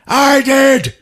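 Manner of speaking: high, loud squeaky admission; high sheepish reply. Retold or imitated. Pacino answering the cop, high and loud. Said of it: "Al Pacino's just like".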